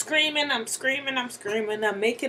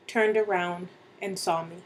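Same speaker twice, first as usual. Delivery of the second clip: plain reading voice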